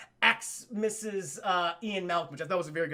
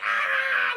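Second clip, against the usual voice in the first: high, loud mock scream; imitated scared scream; 6 dB louder than usual. Acting out kids as the T-Rex bashes in, screaming. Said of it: "They're all scared".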